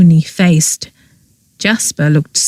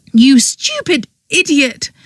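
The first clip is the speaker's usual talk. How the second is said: higher, contemptuous snarl; higher voiced girl snarling at Lorcan; 8 semitones higher than usual. A narrator's reading. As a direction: spit the insult at him. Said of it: "she snarled".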